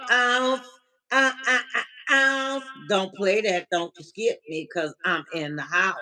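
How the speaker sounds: normal talking voice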